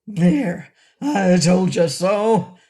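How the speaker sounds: loud, slow, cross goat voice; angry cry. A narrator's reading. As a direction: scold them loudly as the goat. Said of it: "cried the voice angrily".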